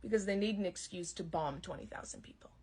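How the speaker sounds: hushed conspiratorial whisper; whispered aside. Real, not acted. Comedian whispering a bitter aside to the crowd, unvoiced. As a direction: drop to a whisper as if sharing a secret.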